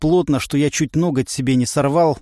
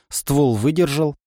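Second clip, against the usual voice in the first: plain reading voice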